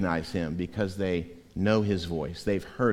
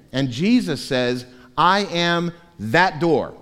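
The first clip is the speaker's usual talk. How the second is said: louder, slower proclamation of the verse; full-force preaching; 6 semitones higher, 7 dB louder than usual. Acted. Preacher proclaiming Jesus as the door, loud and slow. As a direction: declare each line firmly.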